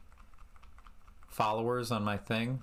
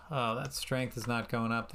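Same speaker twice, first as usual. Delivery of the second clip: usual speaking voice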